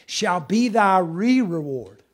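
slow, weighty reading of the verse; slow solemn scripture read. Acted.

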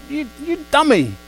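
high, hammed-up scolding; mock angry God. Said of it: "He's just saying".

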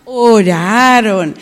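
very slow, stretched ORARON; drawn-out confirmation. Shown as a demonstration. Teacher confirms the child's answer, very drawn-out. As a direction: echo ORARON back, long on each vowel.

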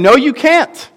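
a preacher voicing God, pleading and insistent; God's impassioned voice. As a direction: plead as God, urgent and lifted. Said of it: "He's saying".

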